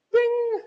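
high bell ding; ding sound. Retold or imitated. Scoring sound effect.

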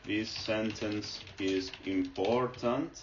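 drawn-out example sentence; slow dictation. Shown as a demonstration. Teacher to viewers, dictating the example slowly. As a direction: spell the sentence out slowly as you type it.

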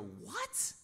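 high-pitched bewildered question; baffled. Retold or imitated. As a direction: react in puzzled disbelief. Retells baffled reaction on reading.

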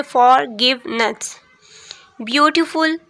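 ordinary talk, nothing marked

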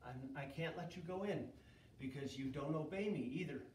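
low, soft and solemn; quiet God. Acted. God quietly rebuking Moses. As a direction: speak it softly and gravely.

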